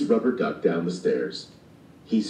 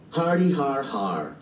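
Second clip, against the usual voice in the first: slow, higher-pitched fake laugh; drawn-out, lightly acted har-har; mock chuckle; 5 semitones higher than usual. A narrator's reading. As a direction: spell out a laugh slowly. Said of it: "chuckled Stink".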